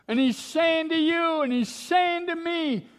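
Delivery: high-pitched, urgent proclamation; impassioned preaching. Acted. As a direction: preach it with rising fervor. Preacher at full force.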